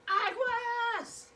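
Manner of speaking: high loud warning shout; yelled warning. Shown as a demonstration. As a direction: yell it like a warning. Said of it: "I am yelling this".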